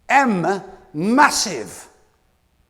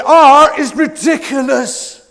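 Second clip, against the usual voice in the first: loud, slow proclamation; emphatic; 8 semitones higher, 11 dB louder, much slower than usual. Real, not acted. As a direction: announce it to the room. Announcing letter with emphasis.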